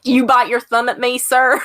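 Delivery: hammed-up southern challenge to a duel; thick southern accent. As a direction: play the Shakespearean quarrel thickly southern. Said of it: "recite Shakespeare at you in a thick".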